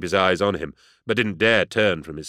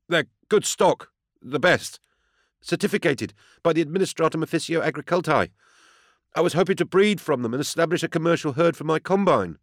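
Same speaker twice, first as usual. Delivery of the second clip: high, earnest farmer pleading his case; high-pitched, eager-to-convince character; nervous defendant; 9 semitones higher than usual. A narrator's reading. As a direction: explain yourself anxiously in a high voice. Defendant explains himself under questioning.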